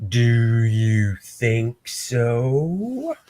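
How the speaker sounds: slow, smug villain taunt. Acted. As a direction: drag out a mocking villain taunt, slowly.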